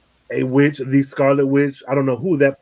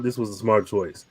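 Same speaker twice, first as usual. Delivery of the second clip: "this was a smart choice" said lower and softer as a summing up; softer recap; 5 semitones lower, 6 dB quieter than usual. Real, not acted. Wrapping up the point for the co-host, lower and quieter. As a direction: drop it low and quiet to close the point. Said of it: "Long story short".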